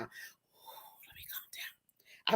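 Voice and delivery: slow, breathy whispered calm down. Real, not acted. Host hushing herself after getting too excited, whispering. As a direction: whisper it slowly to yourself.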